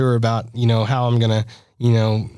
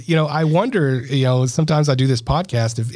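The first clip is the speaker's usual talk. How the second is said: usual speaking voice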